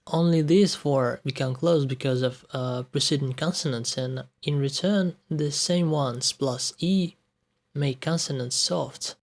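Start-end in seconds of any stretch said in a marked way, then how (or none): none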